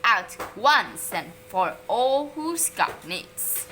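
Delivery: loud, slow menacing teacher; growl. A narrator's reading. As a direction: growl each word slowly. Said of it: "she growled".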